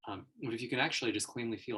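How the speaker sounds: usual speaking voice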